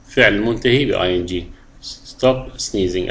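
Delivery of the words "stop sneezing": teacher stressing a phrase; drawn-out phrase. Shown as a demonstration. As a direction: Stop sneezing stretched very slowly. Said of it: "احفظها".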